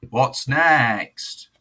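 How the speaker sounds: loud, high shouted lead-in; hyped call-out. Real, not acted. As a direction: holler it to bring on the next act. Hyped host moving on.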